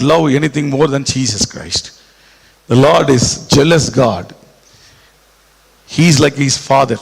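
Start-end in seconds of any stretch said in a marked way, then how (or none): none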